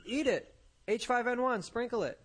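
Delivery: mock barista. S1 mocks barista, laughing.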